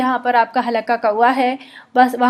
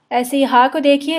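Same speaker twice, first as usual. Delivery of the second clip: ordinary talk, nothing marked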